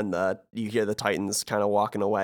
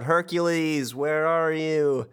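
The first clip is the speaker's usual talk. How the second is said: calling out; 5 semitones higher, much slower than usual. Retold or imitated. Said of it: "Sora yells out".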